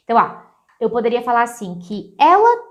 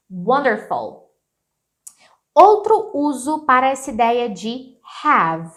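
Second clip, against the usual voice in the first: normal talking voice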